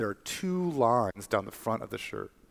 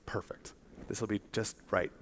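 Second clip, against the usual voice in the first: quiet inner thought; 2 semitones lower, 10 dB quieter than usual. Retold or imitated. Girl quietly pleased with herself.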